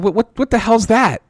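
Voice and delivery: high, loud baffled outburst; stunned disbelief. Retold or imitated. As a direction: blurt it out in shock. Hearing a bizarre diagnosis.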